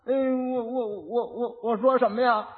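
stammering, stuck on the first word; simpleton husband. Acted. Simpleton asking his wife what to say, stammering in confusion. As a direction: stutter helplessly before asking. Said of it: "傻子就过去了".

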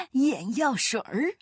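slow, amused astrologer voice between laughs; laughing disbelief. A narrator's reading. Astrologer mocks the eye-drop remedy, laughing. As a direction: say it again through ridicule. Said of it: "星象家哈哈大笑".

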